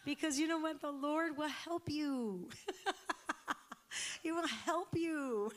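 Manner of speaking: high, slow, reassuring through laughter; laughing. Real, not acted. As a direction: laugh as you promise help.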